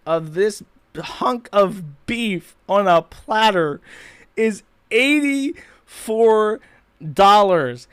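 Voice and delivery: slow, drawn-out game-show host reveal; dramatic price reveal. Pretend. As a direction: stretch it out like announcing the winning price.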